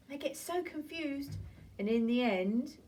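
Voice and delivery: ordinary talk, nothing marked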